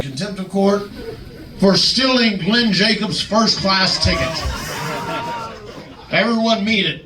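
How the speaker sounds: hammed-up judge announcing the trial; mock court announcement. JBL announcing kangaroo court.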